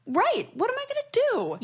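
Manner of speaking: very high, squeaky self-quote of panic; fretful high squeak. Retold or imitated. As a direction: pitch it way up like a fretting self. Retelling own panic over dusty fan.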